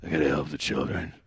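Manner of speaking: low, soft action-hero mumble; hammed-up low heroic murmur; mock Rambo.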